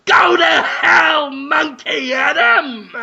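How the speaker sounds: high, slow, drawn-out furious yell; fist-shaking shout. Acted. Willem Dafoe yells out the window at Monkey Adam, hammed-up fury. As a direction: curse him out the window. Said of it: "shakes his fist and goes".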